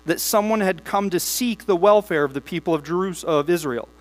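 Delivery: steady, measured Bible reading to the church; congregation-facing scripture reading. Acted. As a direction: read the passage aloud with weight. Reads long Nehemiah passages.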